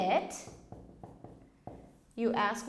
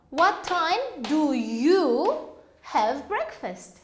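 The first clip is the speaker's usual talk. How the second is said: slow model question; slower than usual. Shown as a demonstration. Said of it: "teacher models question slowly".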